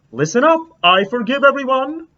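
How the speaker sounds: grand announcement. Acted. Teacher in her story forgiving the class, a grand proclamation. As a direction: announce it like a kindly king.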